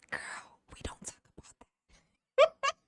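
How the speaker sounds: high breathy secret whisper; hushed whisper. Pretend. Asked about auditions, whispering back secretly. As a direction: whisper it like a forbidden topic. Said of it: "And I was like".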